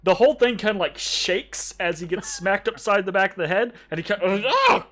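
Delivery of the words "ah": high, loud goblin yelp; startled yelp. Acted. Groin gets smacked by Pibble, yelping.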